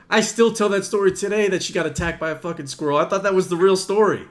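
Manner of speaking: high, loud astonished quote. Retold or imitated. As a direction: replay your own disbelief. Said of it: "I was like".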